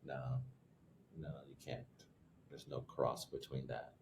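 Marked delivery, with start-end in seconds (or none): none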